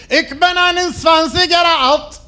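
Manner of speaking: high, slow, loud hammed-up German; mock German. Comedian showing off his one German phrase, hammed-up German accent. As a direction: bark it out in fake German. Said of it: "took three years of German".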